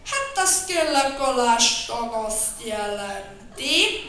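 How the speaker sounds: slow, drawn-out mumble; muttering slowly. Acted. Bear explains the arrest, slow muttering. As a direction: grumble it out slowly. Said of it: "mormogta Medve Medár".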